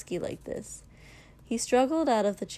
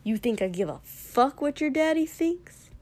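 scornful sneer; 6 semitones higher, 3 dB louder than usual. A narrator's reading. Katsuki mocking Shoto's father.

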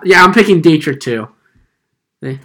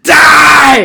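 loud, high mock scream; hammed-up furious yell; shouted die; 11 semitones higher, 10 dB louder than usual. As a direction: yell it like an insane man. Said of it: "he's just like".

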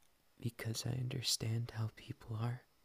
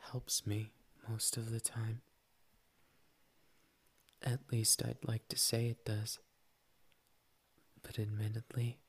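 ordinary talk

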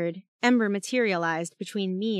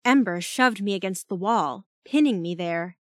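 plain reading voice

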